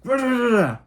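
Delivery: mock angry ranting. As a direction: parody dad's yelling with nonsense sounds. Imitating father's wordless ranting.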